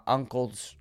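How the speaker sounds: ordinary delivery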